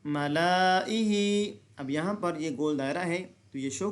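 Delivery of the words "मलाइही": मलाइही chanted slowly as if the alif were read; hypothetical reading. Shown as a demonstration. Teacher showing how it would sound. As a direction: draw out मलाइही as the alternative form. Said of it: "इसको ऐसे पढ़ते".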